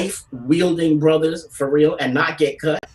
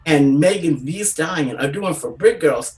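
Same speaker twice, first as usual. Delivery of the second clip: normal talking voice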